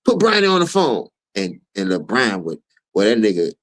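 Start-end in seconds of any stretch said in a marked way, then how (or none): 0.0-1.1 high, loud demand; loud, high-pitched impatient summons. Retold or imitated. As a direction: call out sharply for someone, pitched well up. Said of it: "why you think [name] say".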